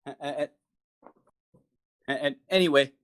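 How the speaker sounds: usual speaking voice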